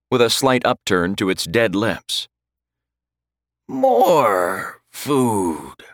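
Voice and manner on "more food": undead creature voice. A narrator's reading. The other Draugr agrees, hungry creature voice. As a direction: agree like a dead thing. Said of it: "the other agreed".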